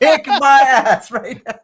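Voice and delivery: high, slow, laughing through it; laughing plea. Pretend. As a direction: beg while cracking up.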